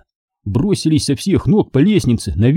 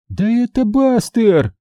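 high, stretched alarmed exclamation; girl's cry; 10 semitones higher, slower than usual. Acted. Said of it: "закричала Минди". (протяжный высокий вскрик)

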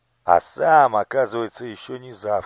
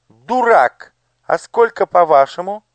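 angry shout; 12 semitones higher, 14 dB louder than usual. Acted. Angry king berating courtiers.

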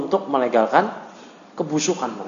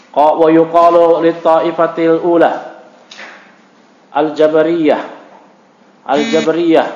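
measured, louder recitation of a text; loud, level classroom read-aloud; reading Arabic text aloud; 4 dB louder than usual. Real, not acted.

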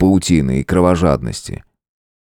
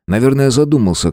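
ordinary delivery, nothing marked

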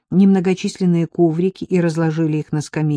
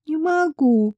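grimacing refusal; 11 semitones higher, slower than usual. A narrator's reading. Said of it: "Марийка скривилась".

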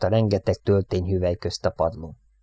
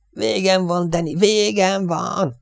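high, stretched-out wail; hysterical despair; 11 semitones higher, slower than usual. A narrator's reading. Airman clings to radioman in hysteria, wailing. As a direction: cry it out as if doomed. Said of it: "hisztériás rohamot kap".

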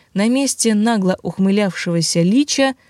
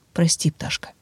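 whisper; 2 semitones lower, 5 dB quieter than usual. Acted. Mark apologizes softly.

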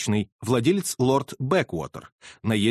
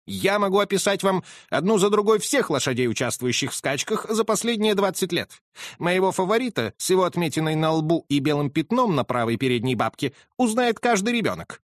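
raised, higher, offended colonel; indignant colonel; 6 semitones higher than usual. Acted. Colonel defends his horse, higher and indignant. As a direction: bristle with wounded pride.

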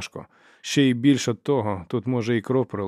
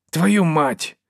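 loud, high exasperated cry; exclaimed curse; 7 semitones higher, 8 dB louder than usual. A narrator's reading. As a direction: burst out swearing to stop the quarrel. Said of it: "вигукнув Шукач". (гучний вигук із лайкою)

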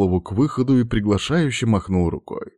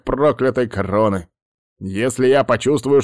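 put-on firm, raised old shaman; 6 semitones higher, 4 dB louder than usual. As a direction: stand firm and command an answer. Shaman threatens skeleton.

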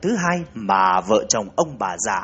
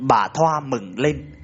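usual speaking voice

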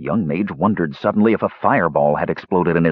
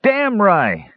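put-on drunken agreement; 11 semitones higher than usual. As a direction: shout it while banging the table. Drunk Palin bangs table.

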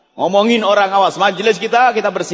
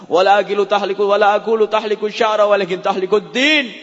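recited Arabic; 3 semitones higher than usual. Shown as a demonstration. Quoting Arabic hadith.

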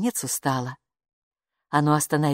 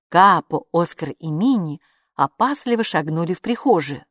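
ordinary delivery

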